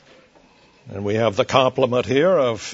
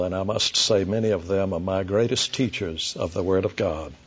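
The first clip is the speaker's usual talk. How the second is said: usual speaking voice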